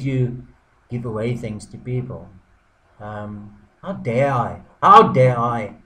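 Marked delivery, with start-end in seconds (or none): 3.8-4.6 mild indignation. Acted. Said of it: "Sorry, I'm getting a bit angry". 4.8-5.8 a much louder, indignant repeat of how dare I; angry outburst. Acted. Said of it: "Sorry, I'm getting a bit angry".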